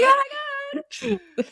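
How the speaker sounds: high, breaking mock squeal; excited. Host imitates getting overexcited on the podcast, squealing. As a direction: gush like you can't contain yourself. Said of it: "Because we'll get excited".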